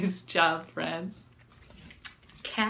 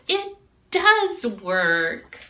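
louder, slow emphatic realization; delighted cat; 2 semitones higher, 6 dB louder, much slower than usual. A narrator's reading. Cat realizes her song works, slow delight. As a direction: draw it out triumphantly. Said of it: "Cat said".

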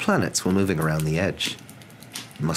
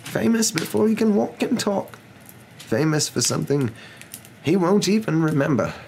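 higher-pitched, measured, earnest wizard; earnest wise wizard; 8 semitones higher than usual. A narrator's reading. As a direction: reason earnestly as an old wizard.

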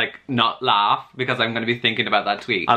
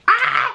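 short high fangirl scream; excited shriek; 4 dB louder than usual. Real, not acted. Fan sees her favourite characters, short delighted shriek.

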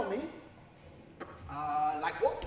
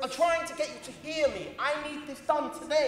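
loud, exasperated insistence; raised voice; 3 semitones higher, 5 dB louder than usual. Acted. Claimant insists to clerk it's needed today, raised voice. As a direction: push urgently to be heard. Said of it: "Lower your voice".

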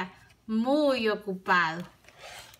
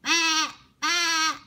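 goat bleat; 7 semitones higher than usual. A narrator's reading. Goat bleat in picture book.

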